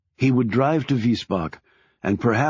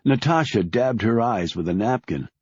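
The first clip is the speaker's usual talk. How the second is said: ordinary delivery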